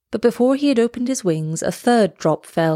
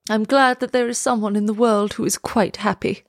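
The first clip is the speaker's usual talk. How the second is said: usual speaking voice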